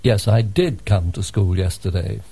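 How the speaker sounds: "did" stressed to contradict; emphatic DID. Shown as a demonstration. Student contradicts the teacher about yesterday, stressing "did". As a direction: insist by hitting "did". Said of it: "using the emphatic form".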